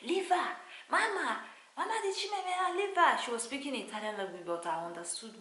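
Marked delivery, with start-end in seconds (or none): 0.0-3.2 high, loud nurse's shout; retold nurse shouting. Nurse shouting at the mother to leave her baby, high and loud. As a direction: yell at the mother to let go. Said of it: "shouting at me".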